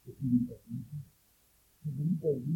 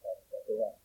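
ordinary delivery

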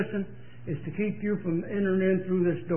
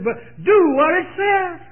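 "do" hit high and loud; stress on do; 9 semitones higher, 5 dB louder than usual. Acted. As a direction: punch the word "do" against "believe". Contrasting believe with do.

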